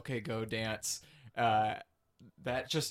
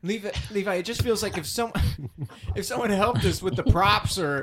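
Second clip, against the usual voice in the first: high, louder pleading; raised pleading; 8 semitones higher, 6 dB louder than usual. Acted. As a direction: push back, pleading loudly. Band pleads with manager.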